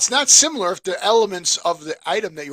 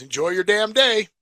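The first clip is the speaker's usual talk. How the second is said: raised, louder guard's send-off; quoting a guard's loud farewell; imitated security guard; 6 semitones higher, 6 dB louder than usual. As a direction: call it out higher and louder, like the guard. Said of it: "And he goes".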